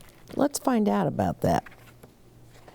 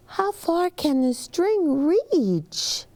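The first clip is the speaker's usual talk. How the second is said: lightly voiced child; 12 semitones higher, slower than usual.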